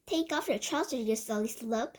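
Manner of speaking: plain reading voice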